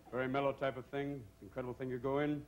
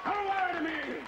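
high, slow, louder accusation; mock accusing husband; 13 semitones higher, 5 dB louder, slower than usual. Husband demanding the truth from wife, high slow mock suspicion. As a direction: drag it out high like a jealous husband.

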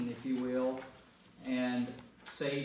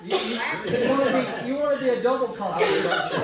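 laughing tease; 9 semitones higher, 11 dB louder, much faster than usual. Real, not acted. Teasing friend amid group laughter.

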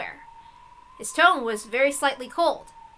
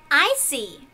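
ordinary delivery

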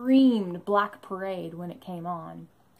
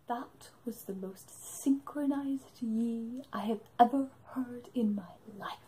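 imitated hushed awe; 7 dB quieter than usual. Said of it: "And Dan just goes".

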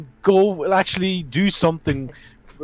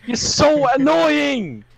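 high, drawn-out angry whine; exasperated outburst; 6 semitones higher, slower than usual. Real, not acted. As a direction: stretch it out, truly fed up. Real rant at teammate.

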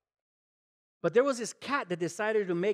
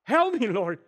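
frightened prayer; 4 semitones higher, 4 dB louder than usual. Retold or imitated. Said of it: "I can picture Daniel saying".

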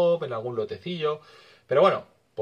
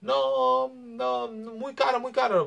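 much higher mock Maghrebi-accented seller; imitating Maghrebi accent; slower than usual. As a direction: play the street seller with a put-on Maghrebi accent, high-pitched. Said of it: "era magrebí".

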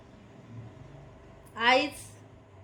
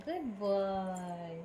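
soft praise; 5 semitones lower, 9 dB quieter, much slower than usual. Real, not acted. Mother gently praising her son, soft and slow.

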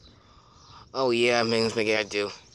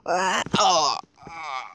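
alarmed cry; 5 semitones higher, much slower than usual. Pretend. Falling off trampoline.

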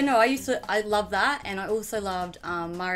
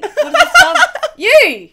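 high, loud words through laughter; laughing; 14 dB louder than usual. Real, not acted. Sister stuck naming pack members, laughing loudly. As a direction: crack up while guessing. Cracking up during guessing game.

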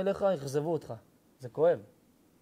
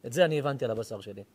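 ordinary talk, nothing marked

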